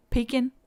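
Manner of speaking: "Pecan" stressed up front with a short kin ending; pecan as PEE-kin. Shown as a demonstration. Trying the other option for viewers, "Pecan" as PEE-kin. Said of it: "what would be the other option?".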